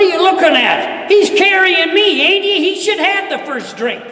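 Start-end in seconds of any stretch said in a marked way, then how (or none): none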